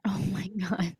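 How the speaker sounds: hushed, stunned reaction; real hushed gasp of disbelief; awed whisper. Real, not acted. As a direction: breathe it out in a shocked whisper. Listener reacts to a shocking ending.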